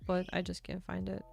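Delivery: ordinary delivery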